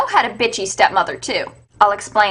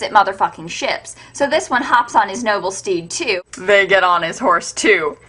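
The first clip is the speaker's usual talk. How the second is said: usual speaking voice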